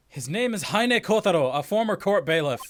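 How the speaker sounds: a touch of dramatic reading; lightly acted narration. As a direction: read it like a case file. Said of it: "you just did it right there".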